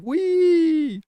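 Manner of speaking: high, loud squeal of glee; giddy institution. Retold or imitated. Imitating a giddy trading firm, gleeful squeal. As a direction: squeal like a giddy kid on a slide. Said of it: "be all giddy about it".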